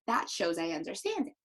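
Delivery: ordinary talk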